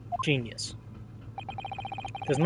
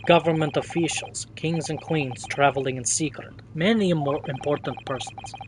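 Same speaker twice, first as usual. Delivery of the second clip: lightly voiced Russian-accented sailor, proud; a hint of a boastful Russian seaman. Sailor showing off the first-class cabins, light Russian accent.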